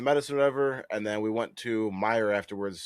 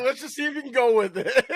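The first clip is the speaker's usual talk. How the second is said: laughing improv quote; 6 dB louder than usual. Retold or imitated. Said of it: "they were like".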